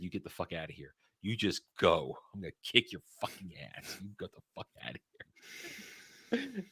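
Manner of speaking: retold menace under the breath; quiet threat. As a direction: warn them off softly, holding it in. Retold threat to gloating friend.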